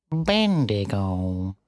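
very slow, drawn-out cat voice; bitten-off word. A narrator's reading. Cat inviting first-name terms, word spat out slowly. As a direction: stretch the name out deliberately. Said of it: "seemed to bite off its words and spit".

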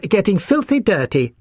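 high-pitched, disdainful poodle voice; fussy poodle puppy butting in; interrupting poodle. A narrator's reading. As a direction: cut in with prim distaste, pitched high. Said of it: "interrupted the Poodle".

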